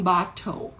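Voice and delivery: "bottle" with a true T; crisp T in British-style "bottle". Shown as a demonstration. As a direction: model British "bottle", T not flapped. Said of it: "se for no inglês, é, britânico".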